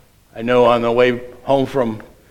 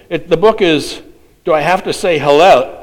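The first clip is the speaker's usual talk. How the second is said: normal talking voice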